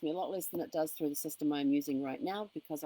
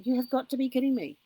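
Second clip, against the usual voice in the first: exasperated; 6 semitones higher, 6 dB louder than usual. Retold or imitated. Retold exasperation at repeated charges.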